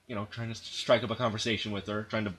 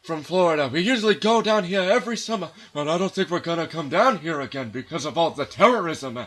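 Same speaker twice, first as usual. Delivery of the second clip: put-on higher, louder chatty old man; hammy old retiree making small talk; old husband voice; 6 semitones higher, 5 dB louder than usual. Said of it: "It's like".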